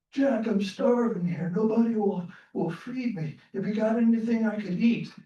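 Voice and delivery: put-on a hungry old head pleading with Jack; starving old man. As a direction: beg weakly for food. Old man begging for food.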